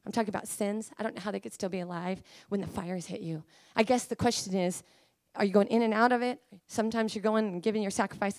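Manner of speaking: normal talking voice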